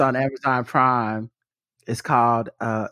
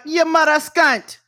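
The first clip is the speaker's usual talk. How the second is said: high, drawn-out Jamaican insult; imitated Jamaican accent; 12 semitones higher, 3 dB louder than usual. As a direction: throw a mock Jamaican curse, slow and pitched up. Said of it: "the way they say it".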